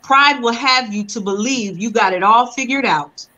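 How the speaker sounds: ordinary talk, nothing marked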